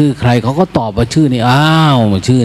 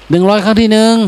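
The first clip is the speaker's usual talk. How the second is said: auctioneer; 7 semitones higher than usual. Retold or imitated. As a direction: cry out the last bid like an auctioneer. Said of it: "ตามหลักการประมูล".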